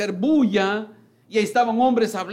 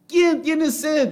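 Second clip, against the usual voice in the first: high, slow called question; calling out; 9 semitones higher, 4 dB louder, slower than usual. Acted. Jesus calling to the crowd in the temple, raised call. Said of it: "él alzó la voz, gritó".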